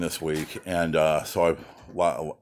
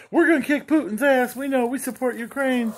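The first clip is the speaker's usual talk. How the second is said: much higher squeaky chicken voice; hens talking. Retold or imitated. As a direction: voice the rebel hens way up high. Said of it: "They're like".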